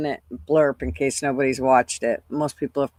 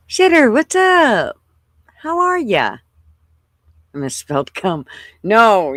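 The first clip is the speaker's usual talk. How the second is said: ordinary talk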